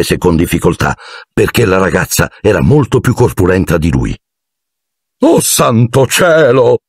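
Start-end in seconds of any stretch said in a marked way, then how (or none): 5.2-6.8 high, slow dismayed exclamation; exclaiming. A narrator's reading. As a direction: gasp at the mess. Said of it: "esclamò Lockhart". (esclamazione sgomenta)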